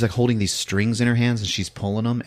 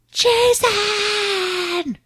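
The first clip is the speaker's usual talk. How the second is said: high, long wailing call; hammed-up high cry of a name; drawn-out call. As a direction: stretch the name into a distant cry. Retold cry heard by hero.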